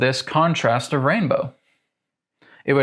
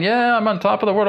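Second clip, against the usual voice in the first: much higher, fast, hammed-up elation on top of the world; mock elation; 8 semitones higher, faster than usual. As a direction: sing it out, mock overjoyed. Voicing life's highs again.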